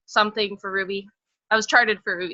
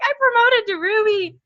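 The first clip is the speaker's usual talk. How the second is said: much higher and louder, bursting with pride; proud announcement; 12 semitones higher, 5 dB louder than usual. Retold or imitated. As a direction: announce the big win, beaming. Announcing promotion to husband.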